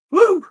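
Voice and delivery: high, loud "woo"; cheering whoop. Real, not acted. Instructor cheers on the working class, whooping. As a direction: let out a short excited whoop.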